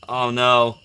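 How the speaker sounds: ordinary delivery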